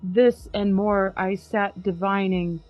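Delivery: slow, grave recital of Poe; dramatic poem reading. Acted. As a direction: read it like a gothic poem by candlelight. Reads poem aloud.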